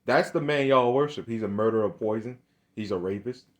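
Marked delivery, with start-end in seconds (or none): none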